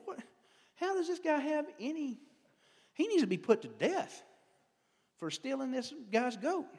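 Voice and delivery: an outraged king demanding death; angry outburst. Retold or imitated. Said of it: "He got mad".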